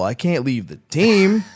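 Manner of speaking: a hint of mock devotion; mock earnest excuse. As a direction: play the loyal cook half-joking. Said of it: "I go".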